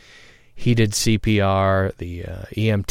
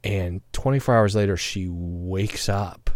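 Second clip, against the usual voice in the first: normal talking voice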